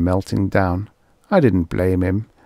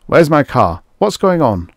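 much higher and louder, alarmed; outraged shout; 6 semitones higher, 6 dB louder than usual. A narrator's reading. The building inspector seeing his huge car, loud alarmed shout. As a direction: yell it out in alarm.

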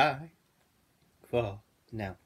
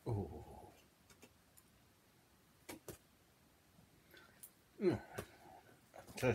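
eating while speaking; talking while chewing; 10 dB quieter than usual. Real, not acted. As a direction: mouth partly full of food. Seen 0: Mouth working early; later unwraps kebab, raises it to mouth.

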